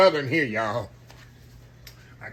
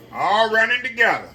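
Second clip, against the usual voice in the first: emphatic repeat; 7 semitones higher, 7 dB louder than usual. Real, not acted. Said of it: "They all running together now".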